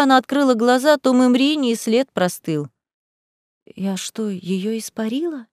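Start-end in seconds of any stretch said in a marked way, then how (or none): 3.6-5.5 hushed, stunned question; whisper. A narrator's reading. Лиза fears she made Мымриня vanish, whispering. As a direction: drop to a very soft, uncertain voice. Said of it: "прошептала Лиза".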